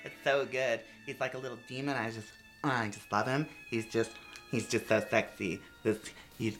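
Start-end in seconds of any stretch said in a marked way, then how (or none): none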